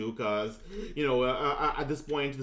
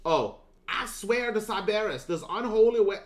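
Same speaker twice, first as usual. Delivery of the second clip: higher, louder Southern accent attempt; failed Southern accent, pitched up; 7 semitones higher, 4 dB louder than usual. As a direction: push the Southern accent before giving up. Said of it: "I can't do a southern accent".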